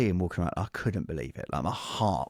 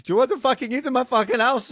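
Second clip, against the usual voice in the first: much higher, louder, furious mock rant; angry parent yelling; 7 dB louder than usual. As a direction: yell it like a livid parent catching the kids eating early. Parent yelling at the dinner table.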